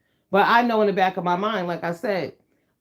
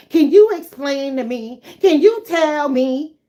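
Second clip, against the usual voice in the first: higher, louder, slow, drawn-out mock of a prissy boss; mocking nagging coworker; 6 semitones higher, 7 dB louder, slower than usual. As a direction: ham up a whiny supervisor, stretching each word. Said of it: "wanna ask".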